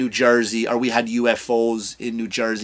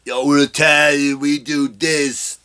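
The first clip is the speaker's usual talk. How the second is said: lightly voiced Jersey Italian bravado, slow; a hint of a cocky Jersey Italian; mock Italian-American comic; slower than usual. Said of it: "stop with the".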